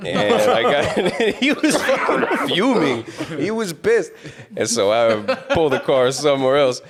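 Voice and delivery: telling it high and breathless through laughter; can barely get the words out for laughing. Real, not acted. Laughing while retelling the fight.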